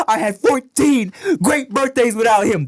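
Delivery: high, loud, sung-out anguished shout; Will Smith's heartbroken line. Retold or imitated. Quoting the Fresh Prince scene, loud anguished shout. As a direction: belt it out like Will Smith breaking down. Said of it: "since Will Smith's dad came and left on The Fresh Prince".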